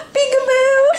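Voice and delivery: bright peekaboo reveal. Real, not acted. Playing peekaboo with baby.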